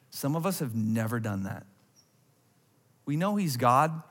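normal talking voice

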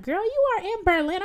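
high, emphatic mock coaxing; high-voiced imitation of an insistent woman; imitated pushy stranger. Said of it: "She's like".

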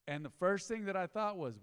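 usual speaking voice

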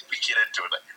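ordinary talk, nothing marked

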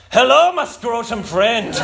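cheeky greeting. Retold or imitated. Friend's cheeky phone greeting.

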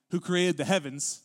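solemn, weighty read of God's own words; scripture reading. Acted. Pastor reading Isaiah aloud to the congregation, solemn and weighty.

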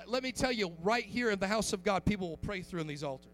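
normal talking voice

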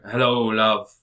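loud, drawled imitation Glaswegian greeting; mock Glasgow accent. Mimicking the TV character's greeting, mock Glasgow accent. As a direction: boom it in a put-on Glasgow accent. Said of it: "He talks like this all the time".

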